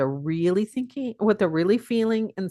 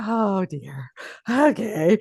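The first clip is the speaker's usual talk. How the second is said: resigned students, imitated; much slower than usual. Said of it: "then they would just go".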